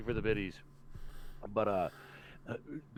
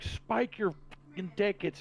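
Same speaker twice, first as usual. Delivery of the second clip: higher, exasperated order to the servers; annoyed outburst; 10 semitones higher than usual. Retold or imitated. Cook ordering servers to spike tickets, annoyed. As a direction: retell snapping in annoyance, pitch jumping up. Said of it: "a little annoyed".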